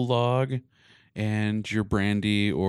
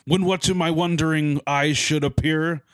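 reading a Christmas poem with drama; dramatic poem reading; 6 semitones higher than usual. Acted. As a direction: recite it like a storyteller. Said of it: "like, dramatic".

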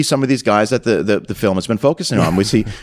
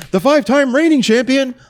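mock announcer; 13 semitones higher than usual. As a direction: hype up the reigning champ like an MC. Announcer introducing champion.

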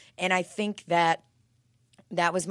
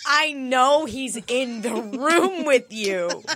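laughing quote; 5 semitones higher, 6 dB louder, slower than usual. Retold or imitated. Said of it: "I'm like".

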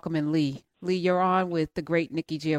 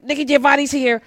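starstruck; 8 semitones higher, 5 dB louder than usual. Retold or imitated. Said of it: "I was so enraptured with".

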